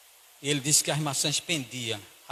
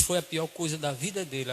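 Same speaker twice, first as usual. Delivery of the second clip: usual speaking voice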